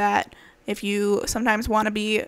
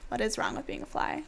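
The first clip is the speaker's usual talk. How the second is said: soft; 3 semitones higher, 12 dB quieter than usual. Acted. Repeated much softer, quicker.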